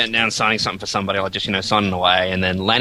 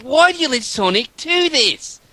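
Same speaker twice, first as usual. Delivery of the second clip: high, hammed-up Australian accent; 14 semitones higher than usual. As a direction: imitate an exaggerated Australian whine of protest. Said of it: "did a great Australian accent".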